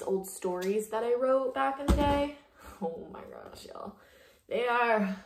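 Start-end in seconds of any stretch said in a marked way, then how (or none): none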